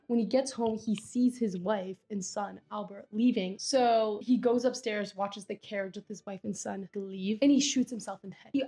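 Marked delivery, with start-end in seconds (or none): none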